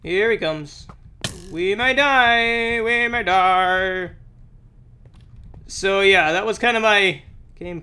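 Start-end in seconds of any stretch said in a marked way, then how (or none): 1.5-2.8 slow drawn-out alarmed cry; dramatic alarm. Pretend. Gamer as boss approaches, slow dramatic alarm. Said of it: "repeated differently". 2.8-4.1 slow, oddly voiced mock dread; hammed-up doom. Gamer as boss approaches, hammed-up slow doom. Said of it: "repeated differently".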